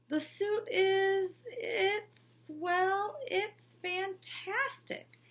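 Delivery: high, slow halting words; fumbling for a compliment; hesitant fake praise. A narrator's reading. Principal pretending to see the suit, slow and halting. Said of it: "said Mister Bundy".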